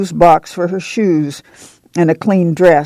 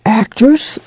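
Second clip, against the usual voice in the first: higher, rising question in awe; awed; 5 semitones higher than usual. A narrator's reading. Girl hears of the stage play, awed. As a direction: ask it in wonder. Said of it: "the girl said in awe".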